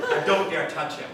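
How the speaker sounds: ordinary talk, nothing marked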